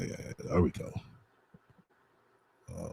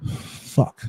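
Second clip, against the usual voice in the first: frustrated curse; 7 semitones higher, 11 dB louder than usual. Real, not acted. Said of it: "I'm getting frustrated now".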